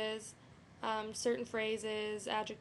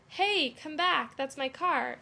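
louder, higher shouted call; yelled demo; 3 semitones higher, 7 dB louder, slower than usual. Shown as a demonstration. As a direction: yell after a car thief. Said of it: "You'd yell it".

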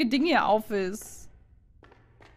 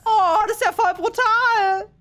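high, loud disgusted outcry; shocked exclamation; 12 semitones higher, 7 dB louder than usual. Real, not acted. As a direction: blurt it out in shock. Horror game shock.

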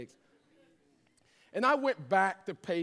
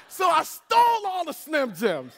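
higher and louder, near a shout; preacher hitting the comic punchline loud; laughing exclaim; 10 semitones higher, 9 dB louder than usual. Real, not acted. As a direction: belt out the punchline with glee. Punchline of funny theft story.

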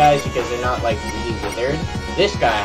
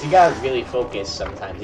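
ordinary talk, nothing marked

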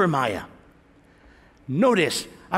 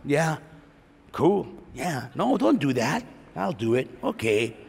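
talking to myself; 6 semitones lower, 6 dB quieter than usual. Pretend. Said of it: "I'm talking to myself".

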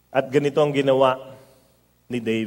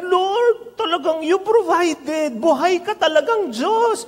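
put-on high, delighted old lady's voice; overjoyed old woman; 12 semitones higher than usual. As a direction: gasp it out like a thrilled grandmother praising God. Old woman thanking God for basket.

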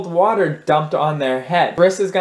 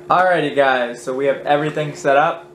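normal talking voice

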